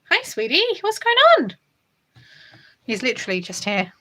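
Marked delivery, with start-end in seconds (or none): 0.1-1.6 high cooing pet talk. Real, not acted. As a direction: coo up high at a beloved cat. Greeting her cat in pet voice.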